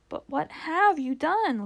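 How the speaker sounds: high, slow, insistent Lucy voice; child's urgent question. A narrator's reading. Lucy asking the fawn what he has done, urgent. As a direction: lift the pitch and press for an answer.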